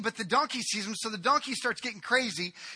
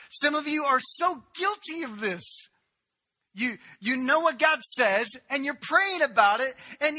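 higher, building preacher's appeal; fervent preaching; 7 semitones higher, 4 dB louder than usual. Acted. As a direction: drive it home to the congregation. Preaching rises to full force.

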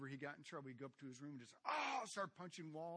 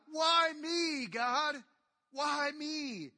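exasperated Moses; 11 semitones higher, 14 dB louder, much slower than usual. Retold or imitated. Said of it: "Moses is telling God".

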